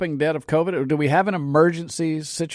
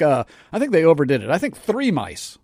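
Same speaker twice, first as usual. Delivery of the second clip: ordinary delivery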